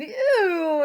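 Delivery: high, loud squealing "ew"; hog squeal. Acted. The sprayed hog screams in disgust, loud squeal. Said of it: "the hog who screamed".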